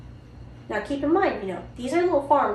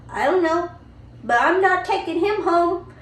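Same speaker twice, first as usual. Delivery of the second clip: put-on higher, louder little boy, stubborn; 7 semitones higher, 5 dB louder than usual. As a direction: dig in like a stubborn toddler. Son refusing Elfie back.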